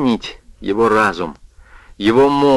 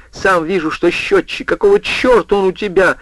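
agitated, higher voice; 8 semitones higher than usual. A narrator's reading. Orson panicking at roommate.

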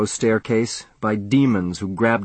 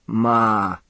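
dry-mouthed bleat. A narrator's reading. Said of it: "like a dry-mouthed lamb at the udder".

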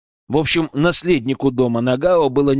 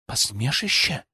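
cold, softer icy echo; 6 dB quieter than usual. A narrator's reading. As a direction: let the word freeze. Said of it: "ледяным голосом ответила Азуми".

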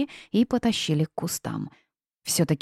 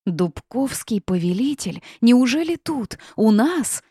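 awed question building to high, loud cries; growing exclamations; 6 semitones higher, 4 dB louder than usual. A narrator's reading. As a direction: build from awe to loud amazement. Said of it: "Всё громче раздавались возгласы".

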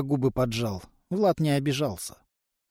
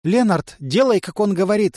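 loud, raised, emphatic order; commanding; 6 semitones higher, 7 dB louder than usual. A narrator's reading. Demoness ordering Lenard to obey, commanding. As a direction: order it with full authority. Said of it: "велела Демонесса".

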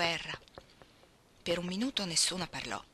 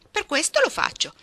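much higher, louder defiant voice; defiant retort; 12 semitones higher, 11 dB louder than usual. A narrator's reading. Jo answering her sister's scolding, loud defiant retort. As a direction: throw it back at her, loud and high.

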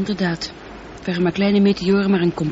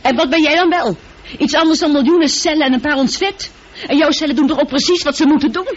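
1 retorting; 6 semitones higher, 3 dB louder than usual. Acted. Computer argues back.

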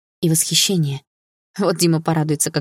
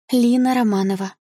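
put-on high, small girlish voice; meek schoolgirl reply, pitched up; timid schoolgirl; 6 semitones higher than usual. A narrator's reading. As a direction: give the name shyly. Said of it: "ответила она тихо".

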